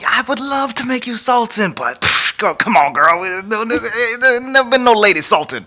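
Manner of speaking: put-on high, fussy old sultan. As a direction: whine it like a flustered old king. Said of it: "he's like".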